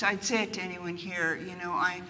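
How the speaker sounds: ordinary delivery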